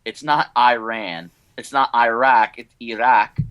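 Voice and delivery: usual speaking voice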